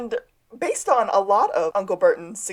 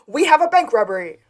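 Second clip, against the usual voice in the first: raised, louder mock yell; retold scream; 6 semitones higher, 6 dB louder than usual. Juliet calling the men to a bank robbery, mock screaming. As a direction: call it out urgently, imitating a scream. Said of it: "And then Juliet screams".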